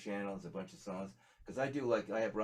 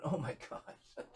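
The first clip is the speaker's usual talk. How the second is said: slow, drawn-out oh my gosh through a laugh; retelling his shocked reaction to grandma's advice, laughing and slow; laughing disbelief; 6 semitones higher, much slower than usual. Retold or imitated.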